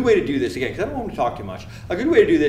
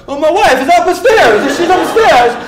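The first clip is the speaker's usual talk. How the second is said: very high, loud put-on holler; hammed-up excitable shouting; frantic patient; 12 dB louder than usual. Patient telling medic where his wife is, frantic mock yell. Patient answering, flailing about.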